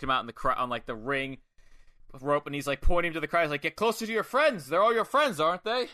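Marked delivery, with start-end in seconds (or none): 3.6-5.9 raised, goading voice; mock taunt. Wrestler shoving rival toward the crowd, mock taunt. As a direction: taunt loudly with a needling question.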